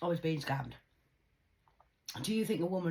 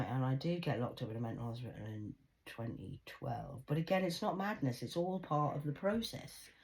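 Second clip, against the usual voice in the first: normal talking voice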